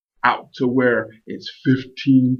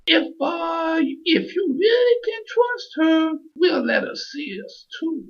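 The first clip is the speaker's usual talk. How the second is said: very high squeaky dolphin. A narrator's reading. Dolphin agrees to include Maria, high voice. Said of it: "The dolphin with the high voice says".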